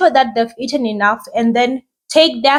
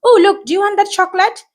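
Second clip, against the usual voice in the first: put-on coaxing toddler; 8 semitones higher than usual. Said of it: "Or her favorite food".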